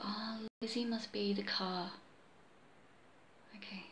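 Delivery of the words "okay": whispered aside. Real, not acted. Reader whispering an aside off the mic, unvoiced. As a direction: murmur it under the breath, voiceless.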